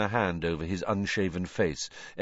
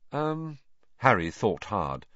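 usual speaking voice

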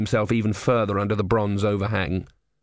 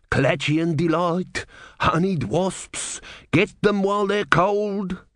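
slow, high hawker's patter; hopeful street seller; 8 semitones higher, slower than usual. Acted. Man with the tray pushing sweets on the crowd, hawking. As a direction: sing out the wares, rising higher at the end.

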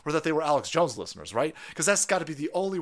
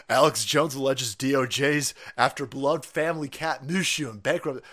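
ordinary talk, nothing marked

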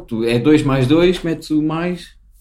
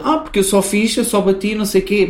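higher, offhand mock teacher; calm dismissive teacher; 6 semitones higher than usual. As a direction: brush it off like nothing happened. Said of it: "bué da calma, como se não passasse nada".

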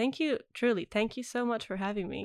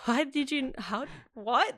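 higher, startled half-questions; baffled surprise; 5 semitones higher, slower than usual. Retold or imitated. Driver startled by a stranger's guess in traffic, baffled. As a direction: stumble over the words in disbelief.

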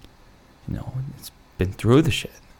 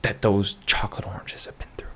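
hushed rallying whisper; mock solemn whisper; 4 dB quieter than usual. Vlogger rallying viewers for leftover chocolates, mock solemn whisper. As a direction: whisper it like a reverent vow.

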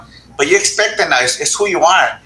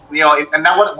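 usual speaking voice

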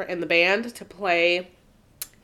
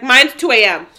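raised, loud quoted brag; sassy one-upping; 5 semitones higher, 10 dB louder than usual. Retold or imitated. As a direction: toss it back loudly with sass. Acting out friend's boastful reply.

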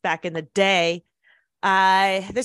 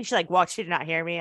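ordinary talk

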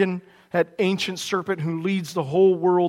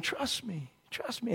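barely-there, much softer snake croon; hushed, sly snake whisper-croon; little snake voice; 3 semitones higher, 16 dB quieter than usual. Retold or imitated. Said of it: "he does his little snake voice".

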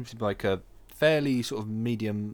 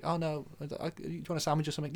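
soft, higher, quick nan voice; imitated nan; 6 semitones higher, 6 dB quieter than usual. Nan offering a friend a sandwich, softly imitated. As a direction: fuss gently as a nan, pitched up. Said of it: "She's like".

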